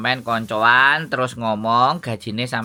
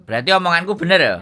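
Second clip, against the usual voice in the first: excited; 7 semitones higher, 4 dB louder, much faster than usual. Real, not acted. Agent vindicated, excited.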